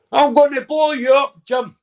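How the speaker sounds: high, loud joke threat; mock threat. Voices a joke bomb threat.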